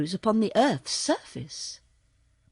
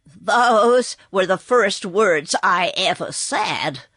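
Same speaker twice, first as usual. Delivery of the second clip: called out; 5 dB louder, slower than usual. Acted. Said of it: "called out the horse".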